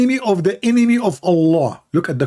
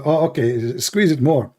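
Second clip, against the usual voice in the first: ordinary delivery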